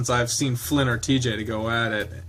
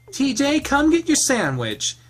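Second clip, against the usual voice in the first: much higher calling voice; 14 semitones higher than usual. Acted. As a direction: call him over, much higher. Calling across the room.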